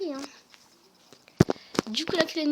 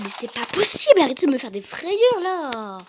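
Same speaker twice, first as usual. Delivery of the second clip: loud startled cry of fright; jump-scared, nearly screaming; startled outburst; 7 dB louder than usual. Real, not acted. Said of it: "J'ai vraiment cru que j'allais crier".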